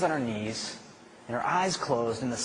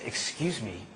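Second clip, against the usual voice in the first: quiet resolve; 4 semitones lower, 5 dB quieter than usual. Retold or imitated. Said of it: "she said".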